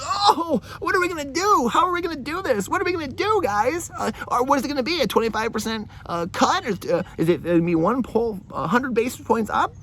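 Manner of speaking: high, whiny panicked mimicry; mock the fretting pundits in a squeaky panic; mocking macro addicts. Vlogger mocking macro pundits in a high panicked voice. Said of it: "they're like".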